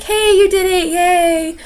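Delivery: high, bright cheer of a proud mentor; cheering excitedly. Retold or imitated. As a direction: cheer her on like she just crossed the finish line. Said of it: "be like".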